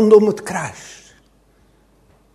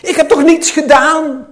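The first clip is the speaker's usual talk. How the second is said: loud, higher anguished outcry; raised, indignant pleading; crying out in protest; 4 semitones higher, 6 dB louder than usual. Acted. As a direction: protest your innocence at full voice. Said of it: "Riep hij".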